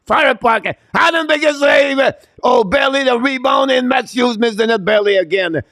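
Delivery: very high, shouted play-by-play; excited shouted call. Real, not acted. Commentator calling a big save on a rush, shouting excitedly. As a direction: shout the save at the top of your pitch.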